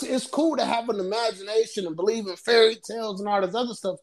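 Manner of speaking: normal talking voice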